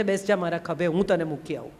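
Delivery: quiet, kind reassurance; gentle offer. Acted. Kabir gently offers to carry her.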